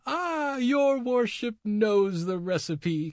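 put-on high, slow old crone with a cackle; gleeful witch cackling; cackling old lady. A narrator's reading. Old witch delighted the devil knows the recipe, high cackling crone. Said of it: "cried the old lady, cackling aloud".

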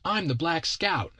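put-on high, drawn-out child's voice; whining kid. Kid protests to his captor about playing scout, high whiny child voice. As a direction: plead like an indignant little boy.

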